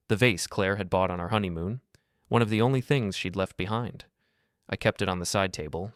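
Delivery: usual speaking voice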